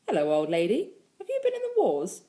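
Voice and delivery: teasing greeting with a high swooping end; playful father. A narrator's reading. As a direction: greet a child with mock surprise. Father playfully greets bumped child.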